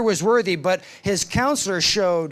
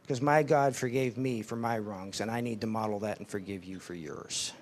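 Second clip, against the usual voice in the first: tender; 7 semitones lower, 7 dB quieter than usual. Retold or imitated. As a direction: quietly reassure the boy. Counselor gently explains forgiveness.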